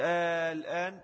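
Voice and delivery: slow, stretched آه leading into الآن; مد الهمزة. Shown as a demonstration. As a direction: hold the opening آه long before الآن. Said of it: "لا إن مد الهمز". (مدّ طويل في «آه» قبل «الآن»)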